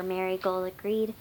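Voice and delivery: usual speaking voice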